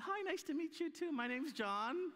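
sarcastically bright reply to the elders who won't hire him: "My name's John" chirped with a rise; sarcastic chirpy greeting. Retold or imitated.

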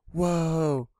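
normal talking voice

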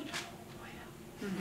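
whispered weary yes; a whispered resigned oh yeah; husband's whisper. Retold or imitated.

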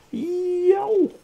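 very high, very loud booming call; giant's loud greeting. Acted. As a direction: greet him from far above. Gigantic person greets him.

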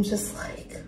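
words barely get out, overcome; choked-up whisper. Real, not acted. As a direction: voice failing into a whisper. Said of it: "getting emotional".

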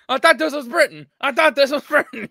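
loud, laughing mock indignation; laughing outrage. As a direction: shout it through laughter. Laughing mock outrage at tube rule.

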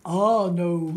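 loud, low big-bear voice; big old bear booming; Papa Bear. Acted. Papa Bear answering Mama Bear, booming low voice. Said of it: "said Papa Bear".

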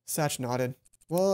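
ordinary delivery

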